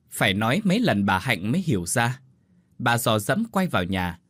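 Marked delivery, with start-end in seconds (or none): none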